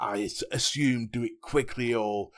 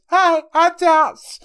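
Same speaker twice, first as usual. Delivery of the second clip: high, loud, drawn-out greeting; mock introduction; 14 dB louder, much slower than usual. Co-host mimicking his partner's new introduction, exaggerated. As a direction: exaggerate the co-host's hello, slow and piercing. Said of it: "Now it's".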